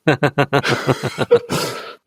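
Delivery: long burst of laughter; real laugh. Real, not acted. Podcaster amused by the plot hole, laughing. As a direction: laugh out freely in a run.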